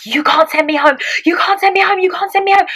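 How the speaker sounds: high-pitched, wailing mock sobs; very high retold hysterical protest; hysterical sobbing. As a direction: plead desperately, acting out hysteria. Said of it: "like, sobbing".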